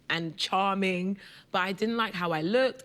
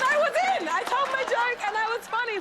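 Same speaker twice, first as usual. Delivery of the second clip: triumphant; 3 dB louder than usual. Real, not acted. Triumphant payoff of story.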